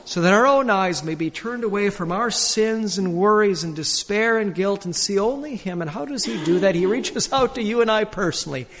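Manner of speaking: ordinary talk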